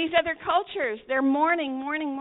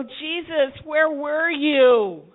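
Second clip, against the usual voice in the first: slow, pleading cry of grief; grieving sisters; 2 semitones higher, 7 dB louder, much slower than usual. Retold or imitated. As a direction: ask him through grief, slowly. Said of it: "they show up and they go".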